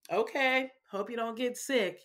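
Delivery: sarcastic parent playing along; playful sarcasm. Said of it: "Join in with the sarcasm".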